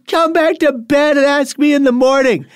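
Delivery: hammed-up wife sending him back to bed; imitating sleepy wife. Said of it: "And she goes".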